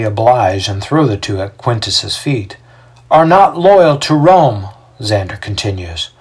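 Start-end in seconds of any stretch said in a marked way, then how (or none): 3.1-4.7 high, loud, slow accusation; public accuser. A narrator's reading. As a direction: denounce them before the crowd. Xander accuses before the crowd.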